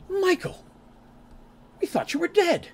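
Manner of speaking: high, loud cry of amazed relief; as if seeing a ghost you're glad of; stunned disbelief. Acted. Knight greets Michael, long thought dead, with loud, high amazement.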